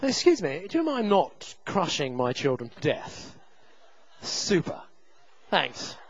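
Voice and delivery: understated, courteous mock request; mock-polite English reserve; very English politeness. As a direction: ask ever so politely, restrained. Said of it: "I was very English about it".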